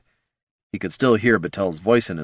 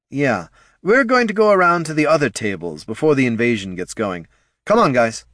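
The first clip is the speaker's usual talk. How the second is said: lightly voiced, raised salesman voice; head of marketing; 6 semitones higher, 4 dB louder than usual. Head of marketing leads the ghouls away, hurried. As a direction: round the group up to move on.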